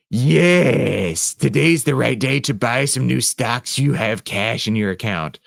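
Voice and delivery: slow, lightly voiced goofy joker; Derpy Joker voice. Shoulder joker urging a buy today, slow mock voice. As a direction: drawl it out like a tempter on your shoulder. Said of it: "He's like".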